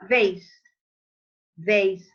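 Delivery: loud, separate "Vase", falling; labiodental V. Shown as a demonstration. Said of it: "Not bilabial".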